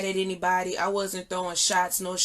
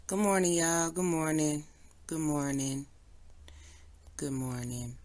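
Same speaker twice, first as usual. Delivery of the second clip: each good morning lower and softer; sinking greetings; 4 semitones lower, 6 dB quieter than usual. Real, not acted. Repeated greeting sinking each time.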